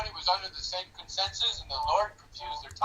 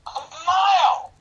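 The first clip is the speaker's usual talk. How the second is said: much higher and louder, slow "A mile!"; excited exclamation; 10 semitones higher, 9 dB louder, slower than usual. Real, not acted. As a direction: burst out in amazement at the size. Emphatic peak of his argument.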